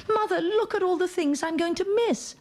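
put-on high, pleading child's voice; childish whine. A young girl begging her mother to stay in England, whining high.

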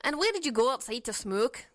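mild Canadian twang; lightly voiced Canadian speaker; Canadian accent. Shown as a demonstration. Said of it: "That's not a Canadian".

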